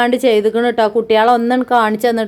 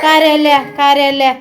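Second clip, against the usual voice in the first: calm the crying little one; gentle don't-cry coaxing; 3 semitones higher, slower than usual. Real, not acted. Soothing a crying child.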